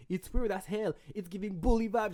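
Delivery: high, agitated retold rant in English; worked-up scolding. Confronting her about the fake account, retold irritation. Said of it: "j'étais trop énervé".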